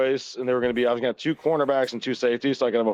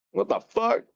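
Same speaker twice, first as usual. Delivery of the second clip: high-pitched baffled Job, acted lightly; mock disbelief, pitched high; retold bewilderment; 10 semitones higher than usual. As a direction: imitate a bewildered man, voice jumping up. Job finds his cattle dead.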